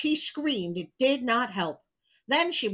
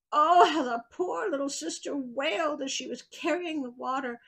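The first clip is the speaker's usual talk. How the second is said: plain reading voice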